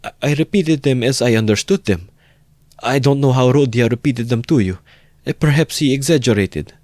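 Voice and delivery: put-on a frightened old mother stumbling; faltering. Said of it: "faltered Pulcheria Alexandrovna".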